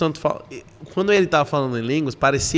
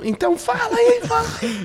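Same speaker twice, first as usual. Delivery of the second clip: very high-pitched exasperated urging; retold squeaky insistent plea; urgent prodding. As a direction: push someone to speak, voice way up. Retells urging her to speak.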